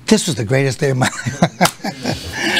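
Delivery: son's line retold through a laugh; laughing quote. Retelling his son's boast, laughing. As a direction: laugh it out as you repeat the boast. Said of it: "he went".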